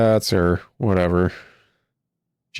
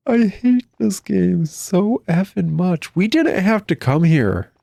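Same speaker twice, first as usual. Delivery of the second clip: exasperated whine; 8 semitones higher, slower than usual. Real, not acted. Annoyed at a pointless game room.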